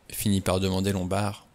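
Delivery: ordinary delivery, nothing marked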